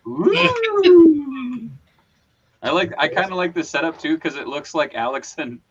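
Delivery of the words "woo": high, loud, long ghost wail; ghostly woo. Pretend. Host playing his own ghost, high playful wail. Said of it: "It's like [name]'s ghost".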